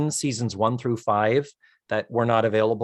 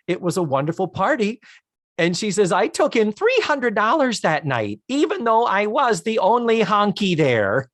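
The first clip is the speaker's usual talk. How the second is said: gushing lady voice; 6 semitones higher than usual. Retold or imitated. Lillian voiced, higher.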